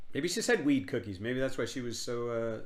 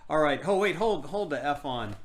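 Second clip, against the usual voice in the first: normal talking voice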